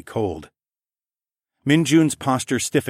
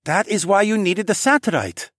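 put-on young man voice; 11 semitones higher, 4 dB louder than usual. Tay voiced, realizing.

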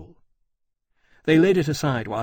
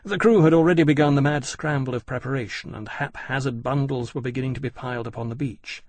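plain reading voice

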